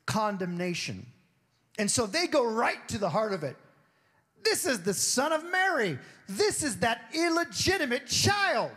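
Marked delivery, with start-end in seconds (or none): 4.4-8.7 much higher, sneering townsfolk voice; scornful disrespect. Retold or imitated. Townsfolk insult Jesus as Mary's son, scornful sneer. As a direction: spit out the insult with contempt. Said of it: "went into disrespect mode".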